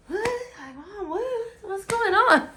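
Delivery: high, confused just-woke voice. Retold or imitated. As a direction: ask what's happening, baffled. Retold own confused waking.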